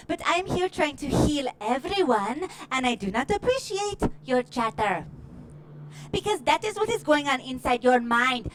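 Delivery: ordinary talk, nothing marked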